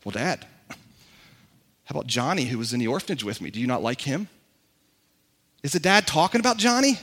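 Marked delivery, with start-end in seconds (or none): 0.0-4.3 put-on soft, low child's question; a son quietly asking his dad; a hint of a worried son. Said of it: "Imagine if Junior says".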